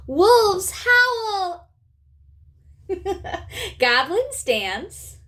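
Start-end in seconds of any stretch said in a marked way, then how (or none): 2.9-3.7 witch cackle. Shown as a demonstration. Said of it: "witches laugh".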